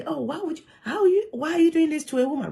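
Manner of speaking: mock outraged bystander. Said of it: "Until be".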